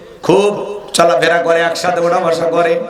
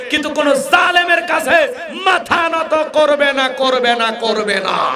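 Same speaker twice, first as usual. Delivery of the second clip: rising defiant shout; 7 semitones higher than usual. Real, not acted. Preacher thundering defiance at the crowd. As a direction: hammer the refusal home, then let it settle.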